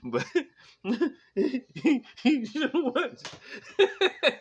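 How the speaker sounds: drawn-out words through giggles; laughing. Real, not acted. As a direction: laugh it out slowly.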